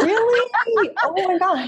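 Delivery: high, laughing astonishment; real surprise. Real, not acted. Guest reacts to host's Australian man voice, surprised.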